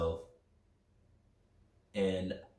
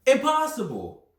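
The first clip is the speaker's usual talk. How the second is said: exclaiming; 13 semitones higher, 6 dB louder than usual. Retold or imitated. Mock exclaiming his old belief.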